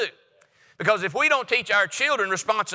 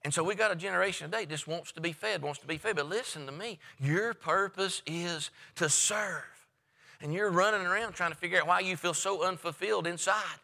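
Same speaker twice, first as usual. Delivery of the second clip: hushed intense appeal; 3 semitones lower, 7 dB quieter than usual. Real, not acted. Preacher drops voice intensely.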